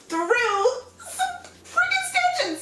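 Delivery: high, slow, crying-out complaint; exasperated whine. Real, not acted. Stuck weaving through stanchions, a high slow exasperated wail. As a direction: wail it out, fed up with the obstacle.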